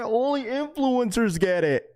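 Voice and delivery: high mocking voice of complaining chatters; high mocking complaint; mocking whiny chat. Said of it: "People are gonna say".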